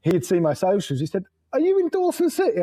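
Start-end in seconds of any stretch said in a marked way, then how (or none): 1.5-2.6 high mock English voice. Mimicking English friend's phone question.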